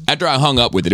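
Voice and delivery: ordinary talk, nothing marked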